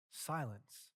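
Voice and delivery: usual speaking voice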